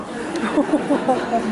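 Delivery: quick amused chuckle; short laugh. Real, not acted. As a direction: laugh it off lightly. Laughs at puke memory.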